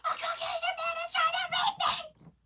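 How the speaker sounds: high, loud mock scream; hammed-up squealing yell; imitated screaming. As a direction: shriek it like a sister's random outburst. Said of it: "she actually started screaming".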